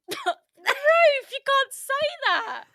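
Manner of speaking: high, loud, drawn-out laughing objection; scandalised through real laughter; laughing protest. Real, not acted. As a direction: protest loudly while cracking up. Laughing protest at friend's blunt insult.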